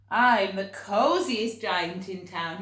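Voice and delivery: loud, slow proud announcement; happy cry. A narrator's reading. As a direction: declare it with delight. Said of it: "he cried".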